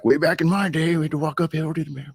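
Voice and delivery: put-on high-pitched jokey old-timer voice; mock old-timer. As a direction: lightly ham up an old-timer's reminiscence. Jokey old-timer reminiscing bit.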